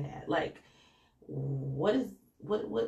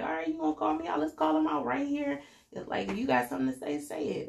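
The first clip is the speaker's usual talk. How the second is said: much higher, louder challenge; imitating Robin calling out; 11 semitones higher, 4 dB louder, faster than usual. Robin daring Karen to say it, higher, louder challenge. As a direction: dare them to speak up. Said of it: "she was like".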